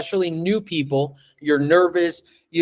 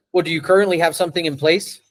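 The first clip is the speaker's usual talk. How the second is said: fast, offhand question; quick, disinterested sales question; rushed, flat question; faster than usual. Shown as a demonstration. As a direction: rattle it off without interest. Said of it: "That's very different from".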